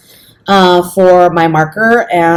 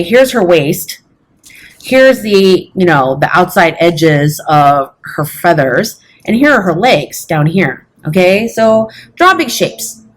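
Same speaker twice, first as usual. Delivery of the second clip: ordinary talk, nothing marked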